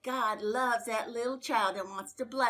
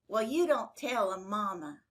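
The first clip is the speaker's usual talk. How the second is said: plain reading voice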